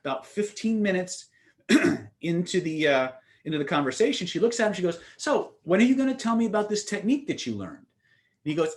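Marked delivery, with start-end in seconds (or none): 5.2-7.8 higher-pitched imitation of a curious girlfriend; curious prodding. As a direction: prod for the promised answer in a higher voice. Retold girlfriend asks about promised technique.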